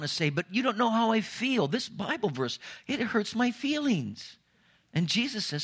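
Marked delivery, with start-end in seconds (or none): 0.3-4.4 high-pitched, whining protest; mock a hurt believer whining at God; pitched-up mock whine, slowing at the end; whiny complaint. Congregant complaining to Jesus.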